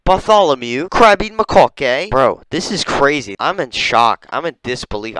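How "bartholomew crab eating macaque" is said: high, loud, hammed-up British; mock British accent. Giving the monkey a British name, mock British accent. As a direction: announce it as an over-the-top Brit. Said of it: "What the fuck is a British name?".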